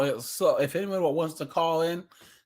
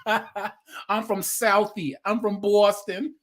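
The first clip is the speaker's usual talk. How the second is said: slow, drawn-out mock Boston accent; 6 semitones higher, slower than usual. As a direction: lay on a Boston accent, slowly and higher. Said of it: "Boston accents are even worse".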